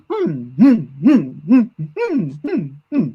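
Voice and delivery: short hums bouncing up and down in pitch; hopping monster hum. Shown as a demonstration. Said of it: "it was kind of bouncing along".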